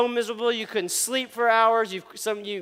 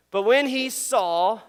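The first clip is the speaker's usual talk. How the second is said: ordinary talk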